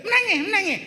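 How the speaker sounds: a high, mildly mocking granny; imitating granny scolding. Granny telling child to cry, lightly mocking. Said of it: "Opah kata".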